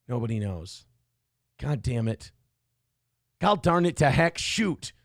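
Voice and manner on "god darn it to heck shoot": Fargo accent. Shown as a demonstration. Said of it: "Nobody knows what I'm doing".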